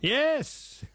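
high, exultant yes; triumphant yes. Real, not acted. Quiz host confirms the caller's winning movie answer, triumphant.

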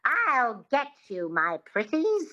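high, slow twangy witch voice; stretched-out witch's taunt with twang; Wicked Witch. Shown as a demonstration. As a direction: model a witchy twang, drawing out the words. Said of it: "Think of the Wicked Witch from The Wizard of Oz".

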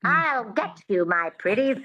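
high, twangy witch voice; Wicked Witch. Shown as a demonstration. Coach showing twang to the learner, Wicked Witch voice. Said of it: "that twang is really important".

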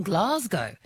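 Glasgow with a long "ah", as "Glahz-go"; imitated pronunciation. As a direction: say Glasgow as "GLAHZ-go", pitch lifted. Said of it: "he says the games are".

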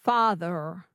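Father copied with an American final R; standard American accent. Shown as a demonstration. Learner repeats after coach, standard American accent. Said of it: "Can you say that?".

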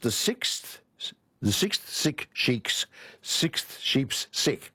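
very slow, careful articulation; slow again. Shown as a demonstration. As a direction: spell out each sound slowly. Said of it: "I'll say it again".

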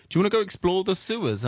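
quick, keen invitation; lightly voiced eager American; eager friend. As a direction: rush it like a bored kid with an idea. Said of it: "be like".

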